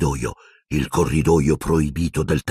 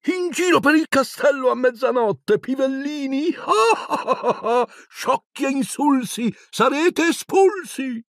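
much higher mocking sing-song with cackles; cackling poltergeist; slower than usual. A narrator's reading. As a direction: taunt the first-years, gloating. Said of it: "Peeves ridacchiò". (Peeves deride i primini, voce acutissima e beffarda)

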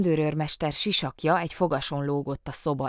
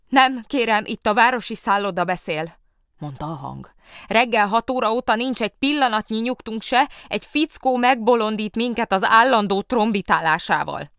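high, louder annoyed hotel clerk; complaining caller; 7 semitones higher, 9 dB louder than usual. A narrator's reading. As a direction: complain down the line.

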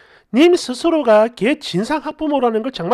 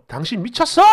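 usual speaking voice

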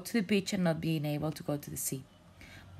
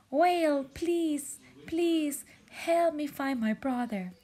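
high, loud begging plea; pleading jellyfish voice; 11 semitones higher, 4 dB louder, slower than usual. Acted. Jellyfish begs whale to find brother, high pleading. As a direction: beg the whale for help, voice pitched up.

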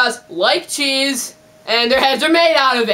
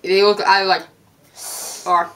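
usual speaking voice

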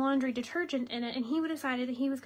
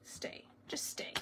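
whisper; 10 dB quieter, much slower than usual. Real, not acted. Coaxing an item to stay put.

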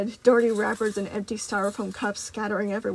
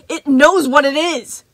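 slow, loud, raised snarl; angry snarl; 5 semitones higher, 10 dB louder, slower than usual. Acted. Moon snarling at Freddy about Gregory, slow and furious. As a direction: spit each word out in fury.

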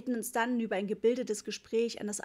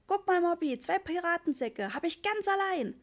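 put-on much higher, childlike proud voice; little boy boasting to his big brother about his find; proud small boy; 8 semitones higher, 3 dB louder than usual. A narrator's reading. Ritchie proudly shows his bags.

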